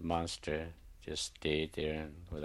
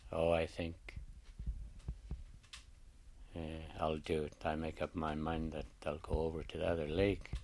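fish telling the raven it will go, softer; fish giving in softly; 8 dB quieter than usual. Acted. Fish agreeing to move.

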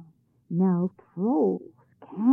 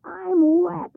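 loud, high wet cry; shouting; 10 semitones higher, 7 dB louder than usual. A narrator's reading. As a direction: yell it out, soaked and shocked. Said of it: "he shouted".

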